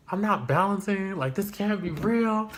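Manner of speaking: rising near-crying alarm at the missing money; panicked disbelief. Retold or imitated. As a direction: voice climbing high and tearful in panic. Panicking at work, drawer short.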